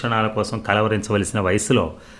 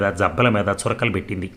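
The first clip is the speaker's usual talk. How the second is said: ordinary delivery, nothing marked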